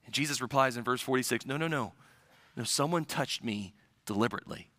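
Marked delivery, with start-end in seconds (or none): 1.5-4.7 soft insistence. Retold or imitated. Jesus gently answers Peter.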